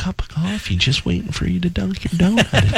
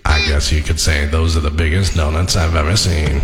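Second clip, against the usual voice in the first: much lower narrator-style impression; Morgan Freeman impression.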